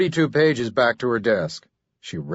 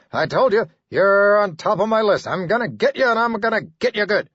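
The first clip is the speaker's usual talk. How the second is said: raving threat; 9 semitones higher, 3 dB louder than usual. Acted. Buchner raving at ceiling.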